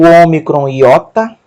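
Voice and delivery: ordinary talk, nothing marked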